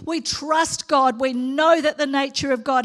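usual speaking voice

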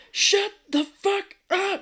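very high, drawn-out mock scream; retold yell. She yells at the concierge, hammed-up scream. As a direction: shriek each word slowly as an imitation. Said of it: "I thought she yelled".

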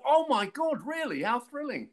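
very high, hammed-up delight; mock thrilled. Actor hearing the news from makeup girls, mock thrilled. Acting out own thrilled reply.

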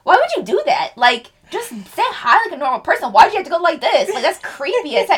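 high-pitched exasperated self-reproach; mock self-scolding. Beating herself up after awkward introduction, mock exasperation. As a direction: berate yourself in comic disbelief. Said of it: "I beat myself over".

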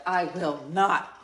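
slow, drawn-out indignant refusal; mock-indignant quote of a stubborn friend. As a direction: say it like you're offended. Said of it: "she says to say it real indignant".

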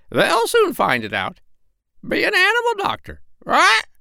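very high, squawky bossy parrot. Acted. Parrot Polynesia scolding the doctor, very high bird voice. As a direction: peck at him with a shrill bird voice.

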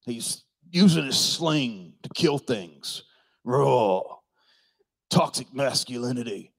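hammed-up gravelly macho growl; slow, rough-voiced mock man's man. Preacher playing Esau with his sling, gravelly mock-macho. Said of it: "he's got the gravel in his voice".

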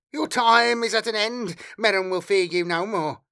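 high, strained, defiant outburst; emboldened hiss. A narrator's reading. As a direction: spit defiance at your oppressors. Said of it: "the bondsman hissed".

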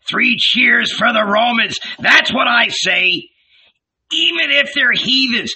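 high, loud scornful rant; ranting old man. A narrator's reading. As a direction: rage and jeer at the crowd. Zebedee ranting, mocking Jesus.

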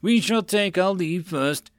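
high, slow, tightly controlled anger; hissed. A narrator's reading. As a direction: take leave with slow, hissed formality. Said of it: "Lord Tarrant hissed".

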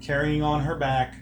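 measured scene-setting storyteller voice; game master narration. Acted. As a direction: set the scene like a GM.

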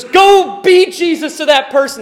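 a bold, driving commission; urgent command. Pretend. Bursts out of you and goes.

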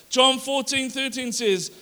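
normal talking voice

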